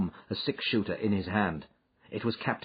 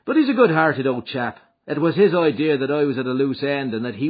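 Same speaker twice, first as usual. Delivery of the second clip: McMurdo, higher and louder, easy and breezy; a quick lie told lightly; voiced McMurdo; 6 semitones higher, 9 dB louder than usual. McMurdo's prepared answer.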